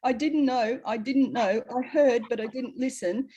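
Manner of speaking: a half-hearted plaintive whine of regret; mock crying. Imagined latecomers lamenting a missed chance, mock crying. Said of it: "you'll be crying and saying".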